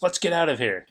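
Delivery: lightly yelled movie quote; mock yell. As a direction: call it out like a catchphrase, half-hearted. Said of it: "where Kelly yells".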